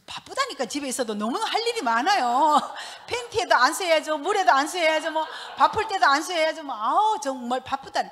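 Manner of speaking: a hammed-up busy housewife, laughing loud; excited laughing rant. Comic rant about being busy.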